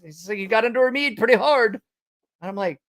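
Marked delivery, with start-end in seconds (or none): 0.4-1.8 high mock British mate; botched British accent. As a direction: tease in a bad British accent. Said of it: "can't do a British".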